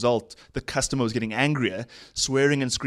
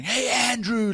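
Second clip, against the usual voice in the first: mock screaming; 12 semitones higher than usual. Imitating a customer screaming at project manager, mock yell. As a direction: call out high like an irate customer. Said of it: "he starts screaming at Andrew like he's the customer".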